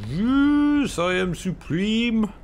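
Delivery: high, drawn-out victorious crow; gloating triumph. Real, not acted. Player crowing to himself that he is supreme, gloating triumph. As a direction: crow it long and loud, high-pitched.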